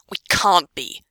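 hard, partly whispered refusal. A narrator's reading. Pansy refusing to be stuck here, hard voice. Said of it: "she says in a hard voice".

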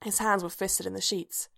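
usual speaking voice

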